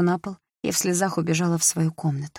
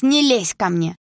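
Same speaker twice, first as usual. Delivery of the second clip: не лезь ко мне yelled louder and higher, stress on не; 5 semitones higher, 5 dB louder, slower than usual. Acted. Sister screaming не лезь ко мне at her brother. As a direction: throw не лезь ко мне back at him as a shout.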